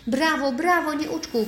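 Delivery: high, loud monkey cheer; delighted high-voiced cry of praise; jubilant shouting. A narrator's reading. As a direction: cheer excitedly, pitched well up. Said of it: "krzyczała małpa".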